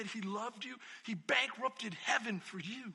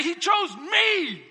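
slow shouted proclamation; 6 semitones higher, 10 dB louder, much slower than usual. Retold or imitated. Preacher voicing David's bold claim, slow shout. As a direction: shout it out slowly, each word heavy. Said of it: "The audacity of him to say".

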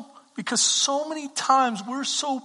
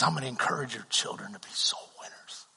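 soft unvoiced whisper, lower; whispered promise; 13 semitones lower, 12 dB quieter than usual. Mentor whispering his plan for the children.

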